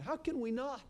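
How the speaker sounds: ordinary talk, nothing marked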